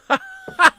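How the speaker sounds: loud, high-pitched burst of laughter. Real, not acted. Co-host cracking up at his own blue-light joke, high laugh.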